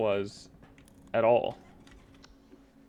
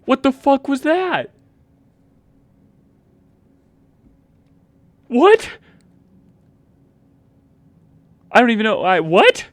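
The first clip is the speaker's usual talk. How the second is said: loud high startled shriek; shocked squeal; 18 dB louder than usual. Real, not acted. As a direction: yelp in disbelief at the noise. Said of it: "that was a nutty sound".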